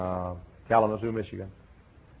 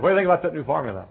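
a touch higher, fast eager question; man on the plane; 6 semitones higher, 5 dB louder, much faster than usual. Retold or imitated. The chemist quizzing the preacher, lightly voiced and fast. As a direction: rattle it off eagerly.

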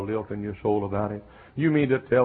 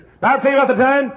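high, loud, fast cheerful aside; hammed-up eager old preacher; old Doctor Bob; 12 dB louder, faster than usual. Preacher imitates old Doctor Bob starting his story again. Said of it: "Right here in this church".

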